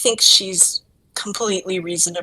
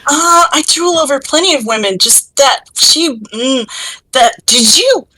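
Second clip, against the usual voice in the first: flustered, stammering denial that falls apart; losing the ability to speak; tongue-tied; 4 semitones higher than usual. Acted. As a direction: stumble and stall, lovestruck. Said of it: "lose your ability to speak".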